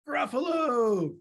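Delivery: "Gruffalo" high and stretched out; dramatic announcement. Acted. Mouse names its feast companion, "Gruffalo" grandly drawn out. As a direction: draw out "Gruffalo" on a falling tune.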